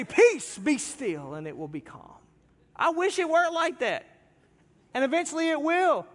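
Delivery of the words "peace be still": mock booming command. Preacher spoofing the showy sermon, booming command. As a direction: thunder it like a stage Jesus hushing the sea. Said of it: "they always do it like that".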